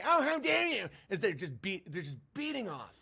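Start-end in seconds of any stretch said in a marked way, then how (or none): 0.0-0.9 mock outrage. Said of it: "people will fight all day".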